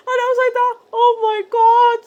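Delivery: high, loud squealing laugh through the words. Real, not acted.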